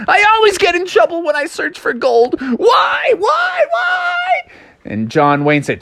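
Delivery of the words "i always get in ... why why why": hammed-up, very high cartoon bawling; mock wailing. A narrator's reading. Froggy lamenting his search for gold, high mock wail. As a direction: rise into a squealing fake cry.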